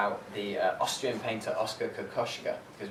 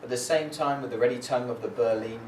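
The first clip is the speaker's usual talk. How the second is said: firm, louder prose reading; literary reading; 4 dB louder than usual. Acted. Poet reading Kokoschka's arrival in Scotland, firm reading. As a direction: read it like a traveller's diary.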